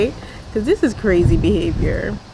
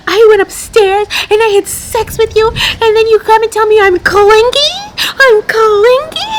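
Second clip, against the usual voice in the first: high, loud whiny wail, much higher, mock sobbing; mock crying Chelsea; 13 semitones higher, 8 dB louder than usual. Mocking the crying fiancee to her viewers, high loud wail. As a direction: ham up a tearful meltdown. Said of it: "she starts crying and she's like".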